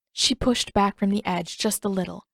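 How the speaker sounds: plain reading voice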